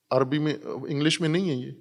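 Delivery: ordinary talk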